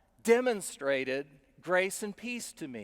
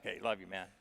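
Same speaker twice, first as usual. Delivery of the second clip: mock man hug; 11 semitones lower, 9 dB quieter, faster than usual. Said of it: "like, man hugs".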